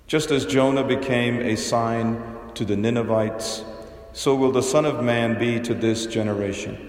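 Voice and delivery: Gospel reading. Acted. Priest proclaims the Gospel reading.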